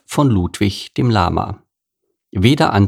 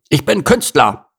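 higher grumbling gorilla voice; sulky gorilla complaining; 6 semitones higher than usual. Acted. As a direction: moan like a fed-up big ape. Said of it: "maulte er".

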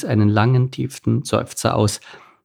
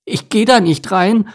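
high-pitched frightened refusal; scared duckling digging in; panicked; 10 semitones higher, 4 dB louder than usual. A narrator's reading. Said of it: "Panisch schüttelte er den Kopf".

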